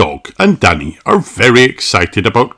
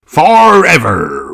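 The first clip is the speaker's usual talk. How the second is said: slow, louder captain voice; Captain Dog replies; 7 semitones higher, 7 dB louder, slower than usual. A narrator's reading. Captain Dog telling Danny he's home for good, slow proud reply. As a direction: promise it grandly. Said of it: "replies Captain Dog".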